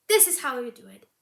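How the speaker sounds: loud, fast and sure of herself; bossy boast. Acted. Rival woman scrubbing fur boasts loudly and quickly. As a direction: show her how it's done.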